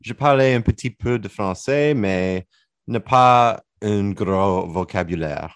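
halting French with an English accent. Real, not acted. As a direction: stumble through French with an anglophone accent. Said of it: "laughing at my pathetic attempt to speak French there".

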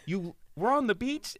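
high, loud disbelieving question; mock incredulity. Challenging his friend's beach story, mock incredulity. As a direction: throw it back as a comic challenge.

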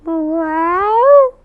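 high, loud innocent meow; high-pitched imitated cat cry. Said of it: "Jenkins looked at her and goes".

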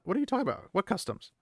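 high-pitched, baffled questioning. Acted. Egwene answers Moiraine's scolding, acted bafflement. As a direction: pitch it up in mock confusion.

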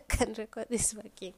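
loud exasperated laugh into the words. Real, not acted. To listeners, after hours recording, loud laughing outburst.

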